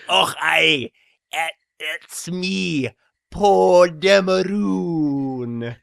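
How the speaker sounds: a hint of a Scottish accent, very slow. A lightly voiced Scottish Poe Dameron introducing himself. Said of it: "I can't do a Scottish accent".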